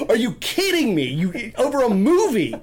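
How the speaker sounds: high, loud incredulous imitation; mock outrage with laughter near the surface; pissed-off roommate. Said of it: "he's pissed".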